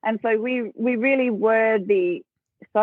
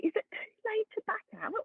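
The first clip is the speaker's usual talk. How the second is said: much higher, much softer tiny voice with a laugh; little inner voice; 13 semitones higher, 12 dB quieter than usual. Retold or imitated. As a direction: squeak it like a nervous little inner voice. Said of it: "I hear this little voice going".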